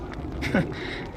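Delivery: breathy scornful chuckle; soft mocking laugh. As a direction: laugh at her scar. Bost mocks the outcast.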